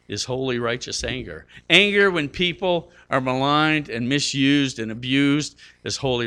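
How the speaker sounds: ordinary talk, nothing marked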